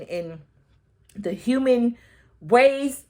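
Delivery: ordinary delivery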